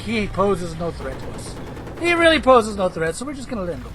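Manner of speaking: lightly voiced smug pilots writing him off; mock enemy pilots. Streamer voicing enemy pilots dismissing his tank, mock low voice. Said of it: "and they're like".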